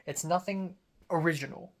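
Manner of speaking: usual speaking voice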